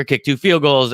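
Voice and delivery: normal talking voice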